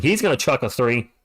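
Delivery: ordinary delivery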